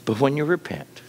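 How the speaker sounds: ordinary talk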